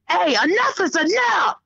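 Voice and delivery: high mock call-out, no real volume; screamed demand from another room. Mother making dinner calls to boys, mock scream. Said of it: "I can't scream demands from another".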